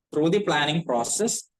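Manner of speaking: slow, spaced dictation with pauses between phrases. Acted. Teacher dictating notes to the class, slow and spaced. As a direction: read each phrase out slowly so they can copy it down.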